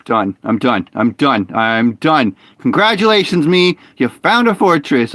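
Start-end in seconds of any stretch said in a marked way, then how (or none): 0.1-2.3 exhausted sigh. Real, not acted. Gives up after long silence.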